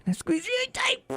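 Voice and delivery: squeaky squeeze. Retold or imitated. Fran bragging about her workout to Freddy, squeal. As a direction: squeak the word up high. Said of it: "Oh, Mister Freddy".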